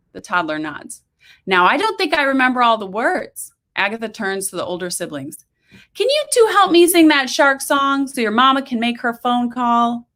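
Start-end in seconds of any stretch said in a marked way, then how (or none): 1.5-3.5 put-on high, bright-toned coaxing of a child; cheery voice to kids. A narrator's reading. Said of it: "booming, cheery voice". 5.9-10.1 put-on high, bright-toned coaxing of a child; cheery voice to kids. A narrator's reading. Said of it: "booming, cheery voice".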